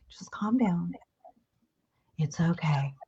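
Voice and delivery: very soft, near-whispered calming; hushed soothing. Acted. Someone to a person freaking out, hushed and soothing.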